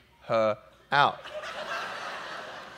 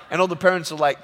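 ordinary talk

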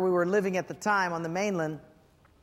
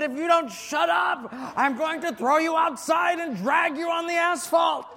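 hammed-up furious dad; 7 semitones higher than usual. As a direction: blow up at the kids like the Hulk, played for laughs. Dad turns around, Hulk rage.

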